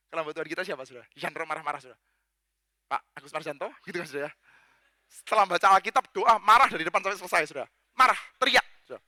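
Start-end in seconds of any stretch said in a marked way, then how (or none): none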